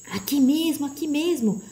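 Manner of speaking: high-pitched guiding cry; urgent advising call. A narrator's reading. The voice advises them where to go, calling out. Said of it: "aconselhou a voz".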